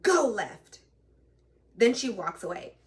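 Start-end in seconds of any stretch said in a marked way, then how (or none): 0.0-0.8 louder, snapped mock command; curt mocking order. Trainer ordering the new driver, loud mock command. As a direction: bark it like a bossy trainer, hammed-up. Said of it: "And she was like".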